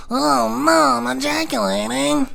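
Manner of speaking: high, drawn-out wail; hammed-up cry of dismay; mock wailing. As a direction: wail helplessly, dragging the words out. Helpless mock wail at climax.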